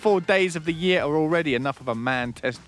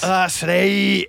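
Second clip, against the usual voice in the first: put-on Scottish accent; 2 semitones higher, 5 dB louder than usual. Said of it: "You sound like Nicola Sturgeon".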